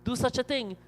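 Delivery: high-pitched, mock-tearful complaint; acted wail of protest, no real sobbing; crying protest. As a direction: plead as the betrayed crocodile, pitch well up. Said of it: "the crocodile now crying and said".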